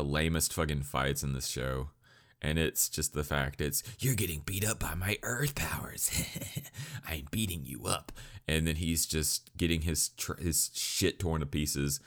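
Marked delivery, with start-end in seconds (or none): 4.0-8.1 breathy, half-whispered mock boast; strained put-on voice with a soft snicker; mock a feeble fighter's bragging; Kuwabara.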